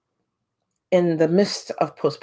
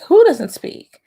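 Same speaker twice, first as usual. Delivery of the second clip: high, loud disbelieving question; incredulous retort; 10 semitones higher, 8 dB louder, slower than usual. Retold or imitated. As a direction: fire it back in disbelief. Mother challenges teacher's claim.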